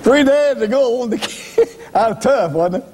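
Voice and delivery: laughing. Real, not acted. Laughing at himself.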